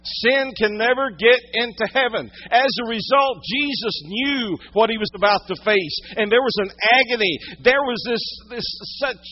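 high, loud, impassioned sermon; pressing the point home from the pulpit; full-force preaching. Real, not acted.

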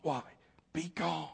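hushed, urgent stage prompt; backstage prompting. A narrator's reading. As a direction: whisper the forgotten line from the wings. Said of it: "the prompter from backstage was saying".

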